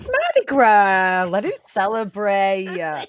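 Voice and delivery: loud, slow mock Shia bravado; Shia impression. To the co-host, loud slow party-boy Shia voice. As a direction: play the rowdy celebrity, slow and loud. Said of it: "You sound just like Shia".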